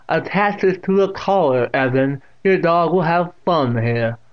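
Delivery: put-on loud, high, slowed old woman; deaf old aunt instructing. A narrator's reading. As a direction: instruct him loudly.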